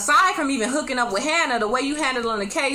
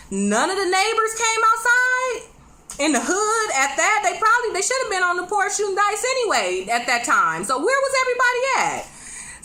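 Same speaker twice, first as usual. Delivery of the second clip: high, disbelieving outcry; can't believe nobody came out; incredulous; 9 semitones higher than usual. Real, not acted. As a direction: pitch it way up in disbelief. Incredulous rant about missing neighbors.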